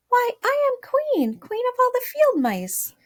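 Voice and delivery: high, loud offended queen; indignant tiny queen voice. Shown as a demonstration. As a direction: puff up with wounded pride. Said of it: "let's read that line with indignantly".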